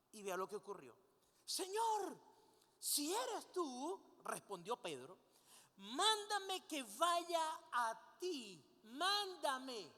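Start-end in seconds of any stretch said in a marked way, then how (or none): 1.4-4.0 high, loud, drawn-out call; acted calling out. Peter calling to Jesus across the water, acted calling out. 5.8-9.9 loud, stretched-out plea called over distance; acted calling out. Peter asking Jesus to summon him, acted calling out.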